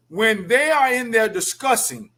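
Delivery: ordinary talk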